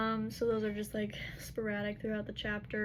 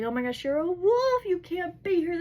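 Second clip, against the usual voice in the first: higher and louder, alarmed yelling; alarmed shout; 8 semitones higher, 8 dB louder than usual. Retold or imitated. Ethan realizes the girl is a wolf, alarmed shout. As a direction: blurt it out in shocked alarm.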